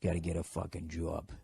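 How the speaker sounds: low, soft Boston "job", like "jaw" plus b; Boston accent. Shown as a demonstration. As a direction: demonstrate the Boston vowel in "job". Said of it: "just say, like, jaw".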